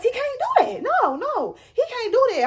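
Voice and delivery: high-pitched, insistent protest; squeaky, mock-outraged refusal; indignant denial. Acting out indignant disbelief about search.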